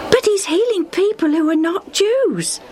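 high, insistent report; excited bystander. A narrator's reading. Bystander spreads word of the healer, high and excited.

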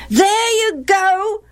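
high, drawn-out sing-song echo; chorusing boys. A narrator's reading. Boys echo their mother at Gilead, chorusing. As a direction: parrot mother like jeering children. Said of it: "the other boys chorused".

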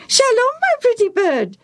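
very high, piercing parrot echo; Bobo the parrot. Acted. Parrot repeats the priest's greeting, squawking. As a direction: mimic the greeting in a squawky bird voice. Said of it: "the parrot repeated".

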